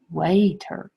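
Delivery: "Waiter" with a long A, like way-ter; wrong rule reading. Shown as a demonstration. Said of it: "An open should be A".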